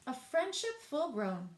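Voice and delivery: ordinary delivery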